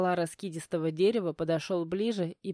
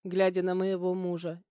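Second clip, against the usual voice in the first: usual speaking voice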